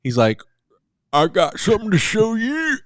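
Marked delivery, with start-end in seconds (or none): 1.1-2.8 high-pitched attempt at a Scottish accent. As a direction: put on a rough Scottish Durin. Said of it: "how do you do the Scottish accent?".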